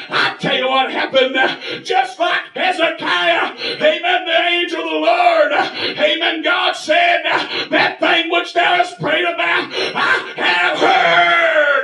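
high-pitched revival cadence; triumphant pulpit shout; fervent preaching. Real, not acted. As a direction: proclaim it in slow punched phrases, building to a long cry. Preaches at full force.

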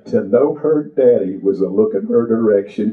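ordinary delivery

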